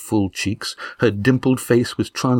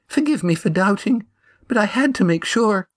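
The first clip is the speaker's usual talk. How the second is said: high, searching voice of a stout widow; widow Amy; 13 semitones higher than usual. A narrator's reading. Widow testing Estrella for burning, high anxious voice. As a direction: probe him gently with a raised voice.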